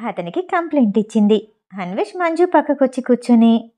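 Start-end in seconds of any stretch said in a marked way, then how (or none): none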